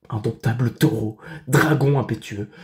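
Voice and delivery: loud, emphatic declamation; exclamation épique. Acted. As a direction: hurl out each epithet of the monster with force. Climax of the monster's description. (exclamation tragique, forte et emphatique)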